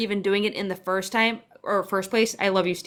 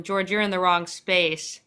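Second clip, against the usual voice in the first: ordinary talk, nothing marked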